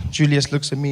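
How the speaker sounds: normal talking voice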